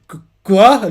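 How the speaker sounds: shocked at a proposed blood pact; startled. Retold or imitated. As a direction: jolt at the idea.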